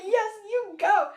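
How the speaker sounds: slow, high, loud cheer. Retold or imitated. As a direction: draw out each word as encouragement. Retells cheering again.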